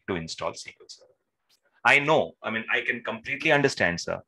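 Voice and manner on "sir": ordinary talk, nothing marked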